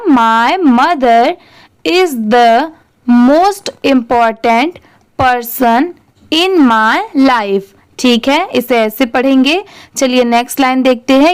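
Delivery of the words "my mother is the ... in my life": very slow, word-by-word reading of the sentence; slow model reading. Shown as a demonstration. Teacher reading the sentence for students to repeat, very slow. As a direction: read it out slowly for learners to repeat. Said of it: "ये पूरा क्या हो जाएगा?".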